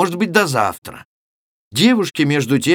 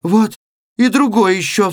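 higher, louder startled yelp; frightened cry; 8 semitones higher, 4 dB louder than usual. A narrator's reading. Weaver seeing a second man in the sack, frightened cry. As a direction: cry out as another body appears. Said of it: "вскрикнул со страхом ткач".

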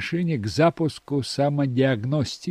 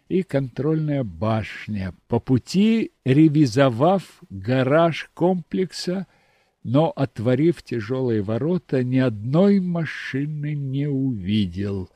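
usual speaking voice

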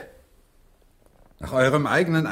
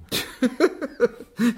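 short laugh; 9 semitones higher than usual. Acted. Shakespeare laughs at the groaning host.

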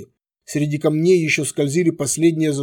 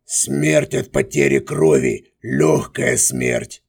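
deep, very slow and calm; unruffled; 12 semitones lower, much slower than usual. A narrator's reading. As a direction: say it low, slow, unbothered. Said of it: "невозмутимо сообщил Козлоногий".

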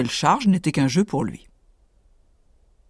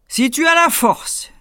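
much higher, louder, slow giant; dim slow booming giant; 9 semitones higher, 4 dB louder than usual. Acted. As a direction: boom the dare slowly. Giant repeats challenge.

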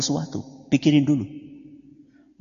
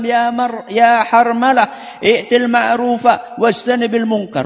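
high, slow, raised Arabic recitation; hadith recitation; 10 semitones higher, 6 dB louder, slower than usual. Acted. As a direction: intone the Prophet's words slowly and high. (melantunkan sabda Nabi dengan nada tinggi dan lambat)

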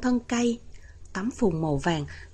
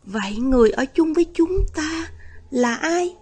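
higher, slightly louder, mildly fearful question; trembling voice; 7 semitones higher, 5 dB louder than usual. A narrator's reading. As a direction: ask it in understated shock, pitched up. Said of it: "Cậu hỏi với giọng run run".

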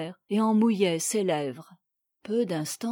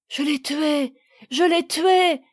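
higher voice, building louder on the repeat; anguished cry; 5 semitones higher, 5 dB louder than usual. A narrator's reading. Husband seeing his wife lifeless, crying out. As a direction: cry out in horror, climbing in pitch. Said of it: "cria-t-il".

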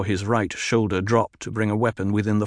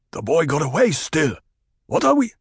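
tribesman calling up; 7 semitones higher than usual. Acted. Tribesman in the basin reports to his leader, calling. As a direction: call up from the basin, unsure.

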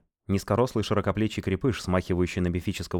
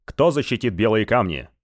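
barked out; 4 semitones higher, 6 dB louder than usual. Acted. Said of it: "гаркнул Рагнейд".

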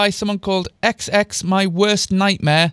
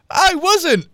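much higher, agitated protest; 12 semitones higher, 3 dB louder than usual. A narrator's reading. Girl talks back to stepfather, agitated. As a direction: jump up in pitch, stung. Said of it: "getting agitated".

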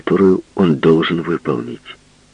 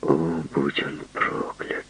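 whispered curse; 8 dB quieter, slower than usual. A narrator's reading. Muttered curse to himself.

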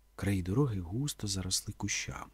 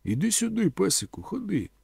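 high, coaxing call; calling a dog; 9 semitones higher than usual. A narrator's reading. Man reaching out his hand to a dog, calling it. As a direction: call the dog over in a raised pitch. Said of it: "покликав".

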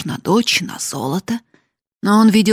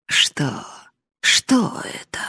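mistrustful question; slower than usual. A narrator's reading. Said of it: "улыбаясь недоверчиво".

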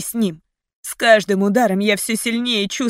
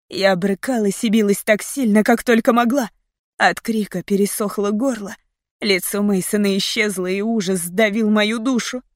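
ordinary delivery

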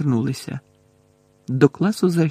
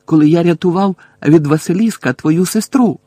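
роздратований крик; 3 semitones higher, 7 dB louder than usual. A narrator's reading. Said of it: "закричав Гаррі".

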